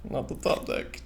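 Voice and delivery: whispered under his breath. Real, not acted. Repeats dismay under breath.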